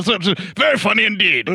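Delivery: high, mocking echo of the review; sarcastic mock quote. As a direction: scoff at the critic's praise. Mocking the Daily Mail quote.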